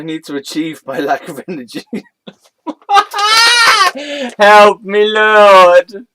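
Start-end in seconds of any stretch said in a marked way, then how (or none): 2.7-4.3 high, loud burst of laughter; helpless high cackle; breaking into loud laughter; big laugh. Real, not acted. Cracking up reading absurd text. 4.4-6.1 mock plea. Mock plea after absurd line.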